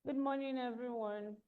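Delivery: very soft, barely audible greeting; too quiet. Real, not acted. Bio reader greeting the webinar, too quiet to hear. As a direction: greet everyone far too softly to carry. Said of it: "Please speak up".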